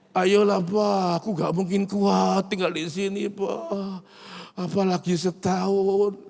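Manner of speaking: high, slow whiny begging; pleading child. Retold or imitated. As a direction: beg like a desperate boy. Son begs to leave orphanage.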